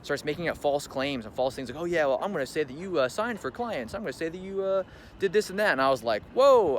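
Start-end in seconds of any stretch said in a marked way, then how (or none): 1.8-5.7 higher-pitched imitated threat; mock threat. Employee making up claims against her boss, mock threat. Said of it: "starts threatening me". 6.3-6.8 loud, high re-enacted "whoa"; startled whoa. Reacting to her false claims, startled whoa.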